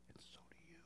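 barely audible whisper; soft whispered aside. Acted. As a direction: whisper it straight to each listener. Intimate aside to congregation.

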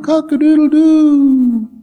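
loud, high drawn-out crow; rooster crow. Retold or imitated. Preacher mimics the morning rooster, loud crowing.

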